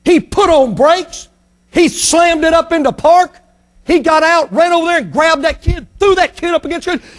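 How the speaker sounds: preacher narrating the angry executive, urgent; dramatic build. A narrator's reading. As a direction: drive the action forward. Dramatic story climax.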